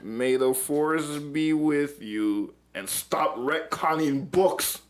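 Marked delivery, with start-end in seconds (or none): 0.0-2.6 slow solemn sign-off. Acted. Sign-off with Star Wars line.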